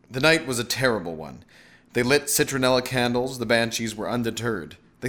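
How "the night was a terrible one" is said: plain reading voice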